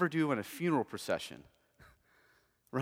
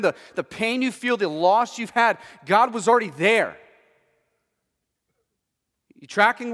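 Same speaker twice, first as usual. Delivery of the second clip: louder, higher, driving sermon voice; full-force preaching; 9 semitones higher, 12 dB louder than usual. Acted. Pastor urging his church, louder and higher preaching. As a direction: preach it out to the congregation. Preaching on God outside time.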